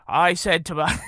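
lightly attempted Kennedy Boston accent. Retelling Ted Kennedy's opening speech, Kennedy accent. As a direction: start into a Kennedy-style accent. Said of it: "get into the accent now".

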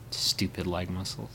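ordinary talk

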